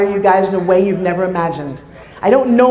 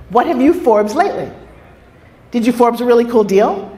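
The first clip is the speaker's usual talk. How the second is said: usual speaking voice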